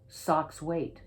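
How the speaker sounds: ordinary delivery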